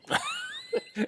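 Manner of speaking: very high, squeaky laugh; real laughter. Real, not acted. Storyteller after the Kent punchline, high laughter. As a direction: burst out laughing.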